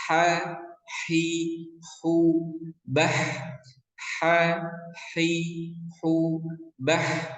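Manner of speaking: ha hi hu bah with a thin, light throat h; thin mid-throat ha, hi, hu. Shown as a demonstration. Teacher drills the letter ha, thin and light. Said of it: "haknya harus tipis".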